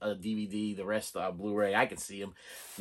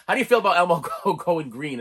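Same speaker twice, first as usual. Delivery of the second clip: put-on fast, loud British accent breaking into laughter; laughing through a British accent imitation; 6 semitones higher, 7 dB louder, faster than usual. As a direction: rush the British interviewer line while cracking up. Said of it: "she's using this British accent".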